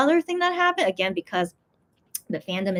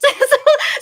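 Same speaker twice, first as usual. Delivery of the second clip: burst of laughter; 8 dB louder than usual. Real, not acted. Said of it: "laughs at her retelling".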